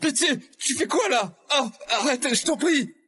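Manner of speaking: high, distressed cries for mercy; frantic pleading. Acted. Begging Liam to stop, frantic pleading. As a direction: beg someone to stop in a high panicked voice.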